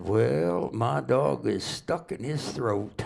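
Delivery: slow, lightly drawled punchline; deadpan Tennessee drawl. Retold or imitated. Stranger delivers the punchline in a slow Tennessee drawl. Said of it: "they talk kinda slow and drawl down there".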